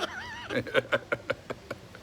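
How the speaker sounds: high squeal of laughter. Real, not acted. Laughs at pet.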